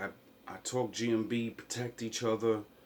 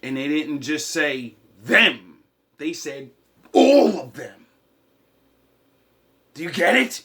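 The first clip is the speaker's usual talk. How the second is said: shouting it out, much louder; outraged shout; 3 semitones higher, 13 dB louder than usual. Real, not acted. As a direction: yell it, building to a roar on all of them. Ranting, outraged at slurs.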